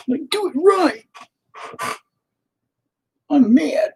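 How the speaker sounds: high, loud put-on indignant voice; mock outrage. Artist mocking fussy film critics with put-on outrage. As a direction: ham up being offended.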